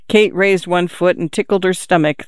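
ordinary delivery, nothing marked

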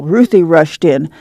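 ordinary delivery, nothing marked

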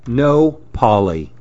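ordinary delivery, nothing marked

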